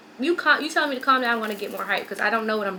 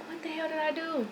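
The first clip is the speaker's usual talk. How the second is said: high, soft, helpless question; retold paranoid bewilderment in a small high voice; bewildered plea; 6 semitones higher, 11 dB quieter than usual. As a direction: ask it high and quietly, at a loss. Retelling her bewildered, paranoid question.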